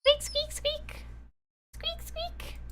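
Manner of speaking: high, thin, soft squeaking; mouse squeaks. Acted. Narrator voicing the caught mouse in distress, high squeaks. Mouse squirming in player's grip.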